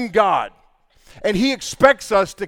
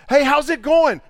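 higher, louder cheery hello; bright greeting; 8 semitones higher, 6 dB louder than usual. Retold or imitated. Cheerful relative greeting a gloomy family member, bright. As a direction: greet them with a big smile.

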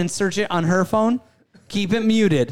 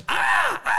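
sharp high bird cry; Cardinals squawk. Shown as a demonstration. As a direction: screech it like an angry cardinal. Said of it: "last year it was the Cardinals".

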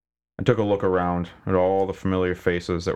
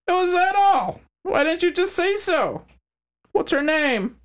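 smug crime boss; 3 dB louder than usual. Acted. McHines voiced character.

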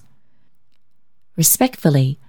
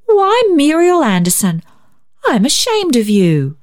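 scolding sister; 9 semitones higher than usual. A narrator's reading. Elder sister scolding younger.

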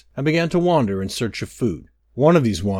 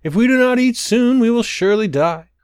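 crying out; 10 semitones higher, 5 dB louder than usual. A narrator's reading. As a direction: stretch it out like starving wanderers pleading. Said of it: "Again they cried".

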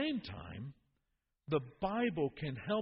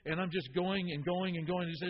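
ordinary delivery

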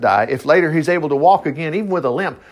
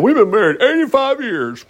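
higher-pitched, hammed-up boast; mock bragging; 7 semitones higher than usual. Couples bragging about their long marriage, mock boastful. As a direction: show off, imitating a proud old spouse. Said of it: "You're just bragging about it".

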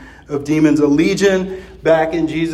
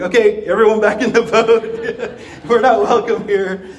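high, louder, laughing retreat call; laughing mock panic; 7 semitones higher, 4 dB louder than usual. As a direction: call everyone back to the boat through laughter. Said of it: "I think I'd probably like".

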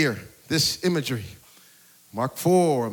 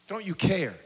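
soft, subdued appeal; hushed plea; 7 dB quieter than usual. Acted. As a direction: drop right down and ask it quietly. Plea repeated softly after pause.

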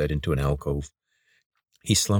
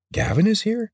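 surprised. Acted. Corvin surprised.

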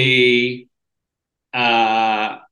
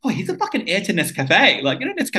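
imitated tipsy gushing, pitched up high; drunk excited girl; 7 semitones higher than usual. Drunk girl telling her friend about the heir, excited. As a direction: play her excitedly spreading the news, voice jumping up. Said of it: "turning to a friend drunk".

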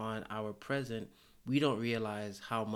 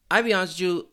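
louder, worked up; 7 semitones higher, 10 dB louder, much faster than usual. Real, not acted. Rant rising.

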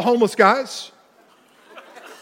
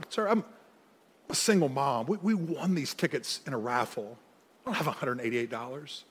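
mild plea; 7 semitones lower, 13 dB quieter than usual. Retold or imitated. Single mom pleads at tow window.

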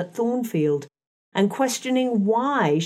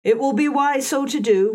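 lightly voiced servant woman, pitched higher; composed servant woman; 7 semitones higher, 3 dB louder than usual. A narrator's reading. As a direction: answer coolly as the self-possessed servant, in a raised pitch. Voices Grace Poole in narration.

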